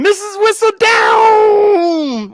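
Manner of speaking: very high, drawn-out shout; slow, high holler of a name; hollered greeting. Real, not acted. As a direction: call out a long, excited welcome. Calling out greeting to arriving viewer.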